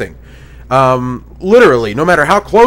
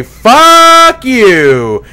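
loud defiant yell, a bit higher; pretend shout; 5 semitones higher, 7 dB louder than usual. Yelling at the Xbox camera in imagined defiance, loud shout. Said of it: "and I'm gonna say".

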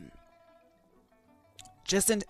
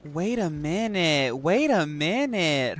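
slow wait; slower than usual. Real, not acted. Realising something in game.

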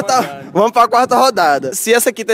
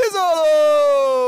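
shout; 8 semitones higher, much slower than usual. Real, not acted. Missed kick called out.

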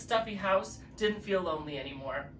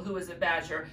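usual speaking voice

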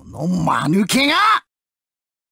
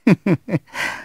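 low villainous chuckle; sinister chuckle; 4 semitones lower, 4 dB quieter than usual. Acted. Villain amused by his foe. (低い含み笑い)

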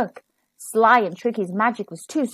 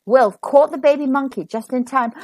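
ordinary delivery

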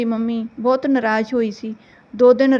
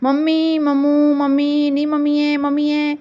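higher, sustained sing-song calling; sharp pestering call; 3 semitones higher, slower than usual. A narrator's reading. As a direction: call out for mum insistently, drawing each call into the next. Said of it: "ਤਿੱਖੀ ਜਿਹੀ ਆਵਾਜ਼ ਕੱਢ ਕੇ ਜ਼ੋਰ-ਜ਼ੋਰ ਦੀ". (ਜਾਣ ਬੁੱਝ ਕੇ ਤਿੱਖੀ ਆਵਾਜ਼ ਵਿੱਚ ਮਾਂ ਨੂੰ ਪੁਕਾਰਨਾ)

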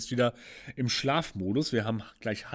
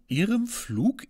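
normal talking voice

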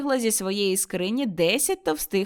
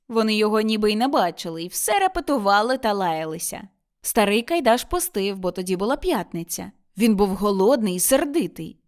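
plain reading voice

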